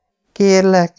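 high, desperate plea; Nancy pleading with the men, high and desperate; pleading woman. Acted. Captive begs her captors.

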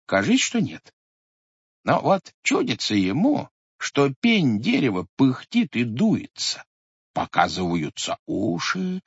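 ordinary delivery, nothing marked

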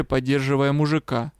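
ordinary delivery

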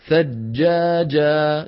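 thin light alif in ثجاجة; slow demo. Shown as a demonstration. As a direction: stretch ثجاجة slowly, keeping the alif thin. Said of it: "لما بتقول مثلًا".

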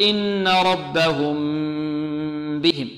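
slow chanted "ربهم", meem held as a long nasal hum; "إن ربهم" drawn out with long nasalisation; lengthened ghunna. Shown as a demonstration. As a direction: hold the hum before "بهم". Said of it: "نطيل الغنة فيها".

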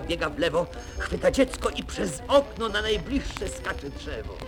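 ordinary delivery

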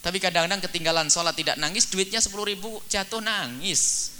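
high, loud sermon climax; fiery preaching. Acted. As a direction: preach it hard to the congregation. Preaching at full force.

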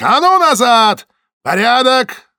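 high, loud barked order; guard's shout. A narrator's reading. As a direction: shout the crowd back.

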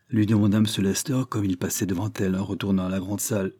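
usual speaking voice

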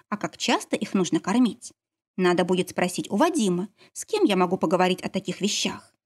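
ordinary delivery

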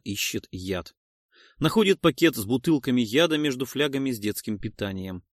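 ordinary delivery

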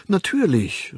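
high, over-hearty assent; exaggerated hearty agreement. A narrator's reading. Bernard covering his hesitation before the young man, overly hearty. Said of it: "durch übertrieben herzliche Zustimmung".

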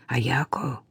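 hushed whisper of her name. A narrator's reading. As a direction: whisper to her so the guard won't notice. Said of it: "прошептала Кира".

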